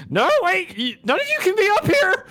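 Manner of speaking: very high, loud panicked cry; frantic stagehand. Acted. Stagehand chasing the goblins, crying out high. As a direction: wail after them in desperation. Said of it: "He's like".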